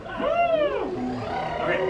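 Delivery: high rising and falling siren; siren wail. Shown as a demonstration. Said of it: "make the siren sound".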